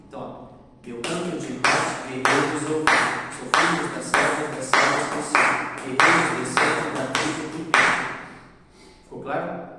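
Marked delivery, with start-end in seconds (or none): 0.9-8.0 loud rhythmic chant marking each stressed beat; drum rhythm recitation. Shown as a demonstration. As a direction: chant it like a drum, punching the strong syllables. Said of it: "eu vou bater palmas e fazer esse ritmo aqui".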